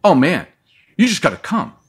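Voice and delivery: loud, high imitation of an eager woman; insistent urging. Woman on the phone urging a caller to come, insistent.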